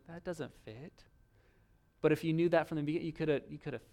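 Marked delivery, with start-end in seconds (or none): 0.1-1.0 muttered complaint. Retold or imitated. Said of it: "and you're like".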